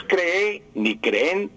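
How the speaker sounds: cree and creen slowly, wrongly stressed on the final E; acento agudo erróneo. Retold or imitated. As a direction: show the error: creÉ, creÉN. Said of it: "No es cree ni creen". (cree y creen con la última e acentuada)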